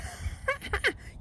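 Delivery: high, airy cackle; breathy jeering laugh. Acted. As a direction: laugh at the bumpkin. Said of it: "He was laughing so hard".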